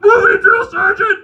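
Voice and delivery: high, loud, drawn-out shout; recruits yelling back. Retold or imitated. Recruits answering the drill sergeant, high strained yell. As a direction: imitate recruits hollering an answer. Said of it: "And we go".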